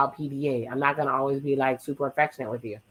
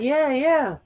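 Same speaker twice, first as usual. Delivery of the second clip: dismissive high yeah; 11 semitones higher, 3 dB louder than usual. Retold or imitated. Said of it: "She's like".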